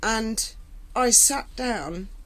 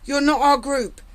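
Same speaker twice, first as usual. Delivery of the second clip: higher, louder mock telling-off; hostile inmates' warning; 5 semitones higher, 5 dB louder than usual. Second table of inmates shooing her away, raised mock hostility. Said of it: "I got the same thing".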